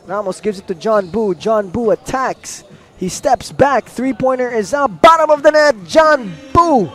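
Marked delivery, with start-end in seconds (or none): 3.0-6.9 excited scoring call. Real, not acted. Commentator calls John Boo's three-pointer, excited and high. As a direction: erupt as the shot drops.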